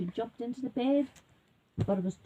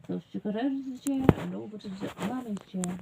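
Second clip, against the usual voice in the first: ordinary delivery, nothing marked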